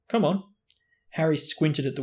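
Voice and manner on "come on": high, eager team captain; keen captain voice. Acted. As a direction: wake him up with drive. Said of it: "said Wood".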